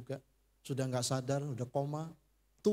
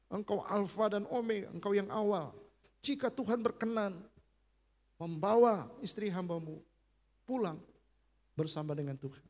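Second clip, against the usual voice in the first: raised, earnest prayer voice; fervent prayer; 5 semitones higher than usual. Retold or imitated. Husband praying over his dying wife, fervent and raised. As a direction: pray aloud at her ear. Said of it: "saya doa di telinganya kenceng-kenceng".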